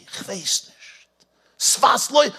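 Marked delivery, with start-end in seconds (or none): 0.0-0.7 whisper. Retold or imitated. Yosef tells Paro he doesn't know.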